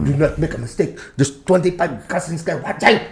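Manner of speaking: mimicked domineering Filipino father; hammed-up accented stage parent; strict stage dad. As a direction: warn the child not to slip up. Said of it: "his dad was like [name]'s dad".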